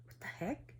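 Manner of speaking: a hushed, breathless what the heck; spooked whisper. Retold or imitated. Grandpa spooked by a crying child, whispering. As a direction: whisper it like you just heard something wrong. Spooked riders in dark canyon.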